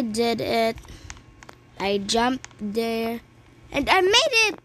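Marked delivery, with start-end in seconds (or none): 3.7-4.6 excited. Real, not acted. Made the jump.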